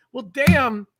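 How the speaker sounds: ordinary talk, nothing marked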